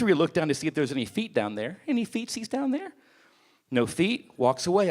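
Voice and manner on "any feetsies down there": put-on cutesy baby talk. Mock cutesy peek under stall.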